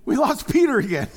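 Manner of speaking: loud laughing aside; laughing at losing his place in the text; laughing at himself. Real, not acted. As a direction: crack up at his own slip. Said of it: "what am I gonna say?".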